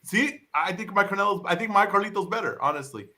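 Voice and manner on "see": ordinary talk, nothing marked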